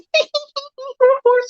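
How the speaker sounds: imitated high cackle; mock high laugh. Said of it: "laughing with Mistress going".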